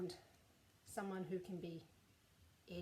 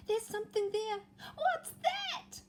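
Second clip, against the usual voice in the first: put-on high, nervous boy's voice; frightened Eddie; 12 semitones higher, 4 dB louder than usual. Eddie noticing a shape in the wood, high and nervous. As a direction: squeak it out scared. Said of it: "he thought".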